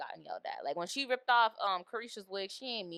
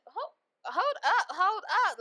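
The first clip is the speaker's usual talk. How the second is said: high, louder, slow protest; indignant hold up; 11 semitones higher, 7 dB louder, slower than usual. Retold or imitated. Reacting to the rushed ending, loud high protest. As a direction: stop them cold, loud and high. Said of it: "I said".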